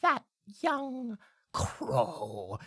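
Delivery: high, slow bird voice; talking blackbird. Acted. Blackbird recalling the crow's mood, slow and high. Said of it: "the little blackbird will say".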